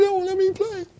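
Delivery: put-on high, whiny little-kid voice; mopey three-year-old. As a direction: complain like a left-out small child. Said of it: "He's Eeyore all over the place".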